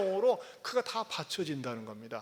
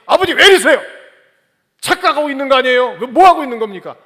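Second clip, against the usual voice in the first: 높고 크게 다급히 외치는 아들; 겁에 질려 빠르게 따지기; panicked protest; 7 semitones higher, 17 dB louder, faster than usual. Acted. 묶인 이삭의 다급한 항의. (묶인 아들이 아버지에게 다급히 외침)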